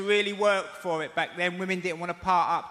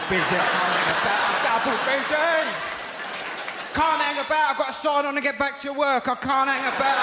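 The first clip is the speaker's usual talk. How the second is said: higher and fast, rushing, no time to stop; busy, rushed Cockney; 6 semitones higher than usual. Pretend. Showing the crowd the busy Cockney Walk, higher and hurried. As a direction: gabble it like a man late for everything. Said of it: "your busy Cockney Walk".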